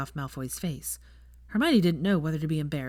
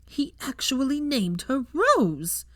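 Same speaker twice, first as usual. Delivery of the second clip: slow, higher, outraged burst; 9 semitones higher, much slower than usual. A narrator's reading. Betrayed woman learns her ex named his daughter, outraged. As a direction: disbelief boiling into rage. Trembling with rage.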